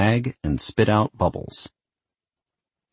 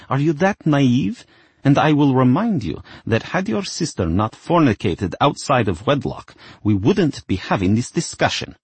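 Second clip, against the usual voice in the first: stern mother; 7 semitones higher than usual. Acted. Mother scolds daughter.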